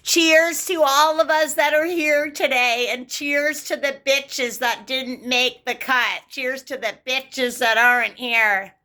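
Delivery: high, slow sneering mock toast; sarcastic high toast mocking the mean girls. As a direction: mimic a snobby girl's toast, drawn out. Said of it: "They're like this".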